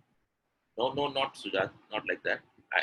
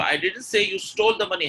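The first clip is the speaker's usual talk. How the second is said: "stole" high and louder; lifted line with weight on "stole"; stress on stole; 6 semitones higher, 4 dB louder than usual. Shown as a demonstration. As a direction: hit "stole", fall on the rest. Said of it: "when I'm stressing on stole".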